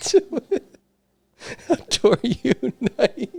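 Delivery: high, trying to stay in the role while laughing; giggly reading. Acted. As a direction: push through the line still laughing. Still giggling through lines.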